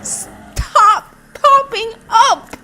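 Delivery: high, loud, strained pleading at enemies; frantic high-pitched outburst; exasperated yelling. Real, not acted. Player yelling at monsters that keep popping up, exasperated.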